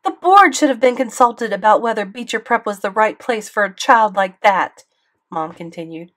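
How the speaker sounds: loud, high angry tirade; mother ranting at the school board, loud fury; furious rant. A narrator's reading. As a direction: tear into the principal. Mom continues tirade.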